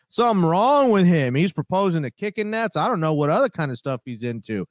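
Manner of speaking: over-the-top McNabb, pushing it; Donovan McNabb impression. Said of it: "he was so over the top".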